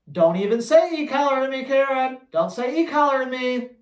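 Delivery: don't you dare say it; stern scolding. Real, not acted. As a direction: scold Karen for asking too soon. Scolding the caller Karen.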